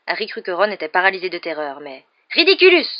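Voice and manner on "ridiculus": high, loud yelled spell; yelling. A narrator's reading. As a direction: yell the incantation out. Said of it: "hurla Ron". (hurlement aigu de la formule)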